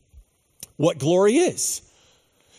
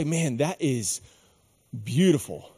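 hushed awe; 6 semitones lower, 7 dB quieter than usual. Retold or imitated. Awed reaction to beauty.

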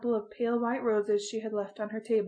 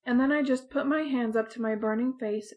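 plain reading voice

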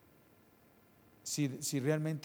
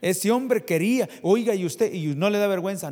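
loud rebuke; 6 semitones higher, 10 dB louder than usual. Acted. Preacher mimics the interviewer's rebuke.

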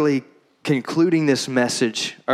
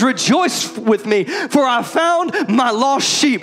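higher, slow, exultant call to friends; joyful shepherd; 9 semitones higher, slower than usual. Retold or imitated. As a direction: throw a party, shout it with joy. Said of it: "calls his friends and says".

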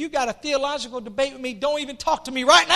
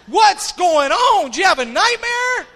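loud, higher-pitched alarmed father, acted out; startled awake dad; 6 semitones higher, 7 dB louder than usual. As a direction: jolt awake and demand answers, hammed-up. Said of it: "that'll wake a dad up like that".

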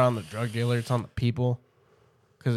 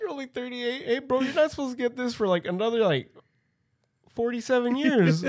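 high mock voice; 11 semitones higher than usual.

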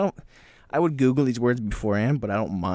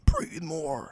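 mock yell. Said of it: "someone's just yelling".

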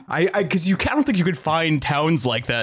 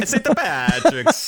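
slow, high mock Italian accent; 6 semitones higher, slower than usual. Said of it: "like, an Italian accent".

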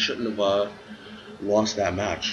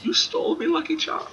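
put-on squeaky, much higher failed Irish accent; laughing, hopeless Irish accent attempt; bad Irish accent. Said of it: "I can't do an Irish accent".

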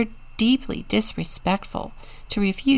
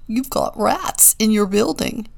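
mild gleeful pigeon voice; gossipy pigeon, lightly gleeful; gleeful burbling; 3 semitones higher than usual. A narrator's reading. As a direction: share the news with a touch of glee. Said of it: "he burbled with glee".

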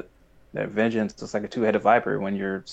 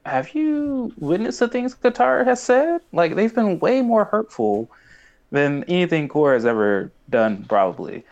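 mock retort; 8 semitones higher than usual. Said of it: "I'm like".

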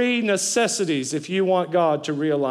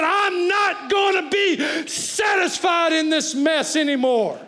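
crying out louder; 7 semitones higher, slower than usual. Retold or imitated. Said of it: "they cried out even louder".

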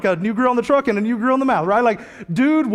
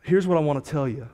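quiet sermon appeal; 4 semitones lower, 3 dB quieter than usual. Acted. Pastor invites the congregation to respond, softly pleading. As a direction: draw the congregation gently toward prayer.

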